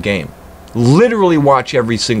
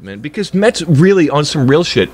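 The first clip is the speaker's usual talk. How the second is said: ordinary delivery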